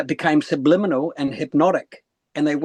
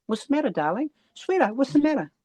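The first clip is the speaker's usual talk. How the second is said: higher-pitched, tender coaxing; concerned nana; 9 semitones higher than usual. Retold or imitated. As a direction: voice a nana gently checking on an upset child. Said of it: "She goes".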